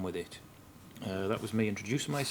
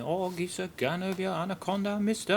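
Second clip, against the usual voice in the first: much higher, hammed-up Geordie banter; cheeky Geordie plea, much higher; mock Geordie accent; 10 semitones higher, 4 dB louder than usual. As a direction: lay on a broad Geordie accent, pitched way up and louder at the end. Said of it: "go on all Geordie on you".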